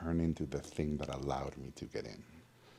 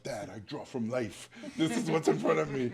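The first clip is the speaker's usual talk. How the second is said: mock teen protest; 7 semitones higher than usual. Teen defending himself to father.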